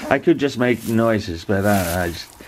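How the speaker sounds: ordinary delivery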